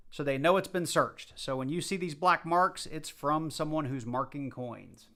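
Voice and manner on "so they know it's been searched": normal talking voice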